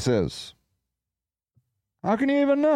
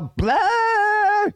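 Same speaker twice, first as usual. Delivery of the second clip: high mocking blah blah of hyenas; squeaky high hyena babble; yelling hyenas; 12 semitones higher than usual. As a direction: yap it out high like a cackling hyena pack.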